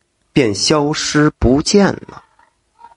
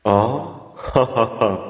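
stroking his beard, a low soft laugh; low old man's chuckle; 6 semitones lower, 6 dB quieter, much slower than usual. A narrator's reading. Old immortal laughs at Zhang San, soft low chuckle. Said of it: "白胡子老头儿抚须而笑".

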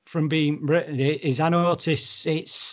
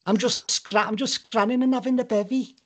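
high mock-Scouse lilt; sing-song Scouser, pitched up; Liverpool accent; 7 semitones higher than usual. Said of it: "that's Liverpool".

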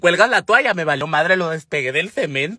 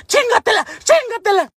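very high, loud shriek; re-enacted hysterical yelling; frenzied screaming; 5 dB louder than usual. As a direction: imitate a mother screaming orders in a frenzy. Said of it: "hizo la pinche gritadera".

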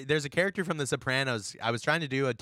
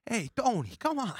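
high-pitched pleading wise guy; Bobby Bacala; 11 semitones higher than usual. Shown as a demonstration. Host imitating a lovable Sopranos character, high and pleading. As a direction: put on a high, wheedling mobster voice. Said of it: "he just has, like, this".

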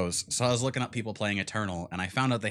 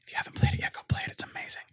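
hushed whisper to keep the secret; spoiler whisper; much faster than usual. Real, not acted. Hiding a spoiler from listeners.

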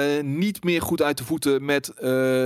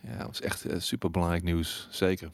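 sarcastic; 6 semitones lower, 8 dB quieter than usual. Real, not acted. Mocking the fuss over news.

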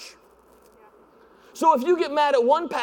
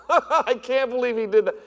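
sarcastic disbelief. Retold or imitated. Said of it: "cynical, sarcastic".

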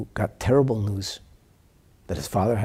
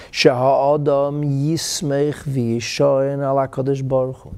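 slow, raised-voice Hebrew declamation; declaimed quotation; 4 semitones higher, 6 dB louder, slower than usual. Acted. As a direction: proclaim the sacred text slowly and loudly.

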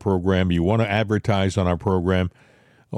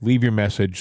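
ordinary talk, nothing marked

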